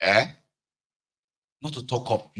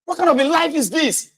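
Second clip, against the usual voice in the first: high-pitched exasperated shouting; ranting at the family about having no peace. Retold or imitated. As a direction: complain at the top of your voice. Ranting in his house.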